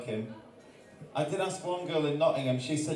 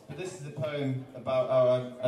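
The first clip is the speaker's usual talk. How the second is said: normal talking voice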